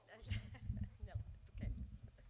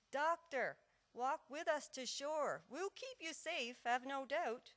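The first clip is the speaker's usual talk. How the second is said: higher, louder shout performed within a poem; recited shout, raised and drawn out; calling out; 6 semitones higher, 5 dB louder, slower than usual. Acted. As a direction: call across the ice to the boat. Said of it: "shouted to Nurse".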